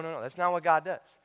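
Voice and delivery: usual speaking voice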